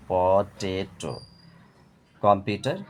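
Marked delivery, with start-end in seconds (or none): 0.1-1.2 পটেটো very slow as প, টে, টো; split in three syllables. Shown as a demonstration. Teacher demonstrating syllables, পটেটো in three slow parts. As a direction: stretch each beat of পটেটো. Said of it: "তিনবারে ভেঙে উচ্চারণ করা হয়".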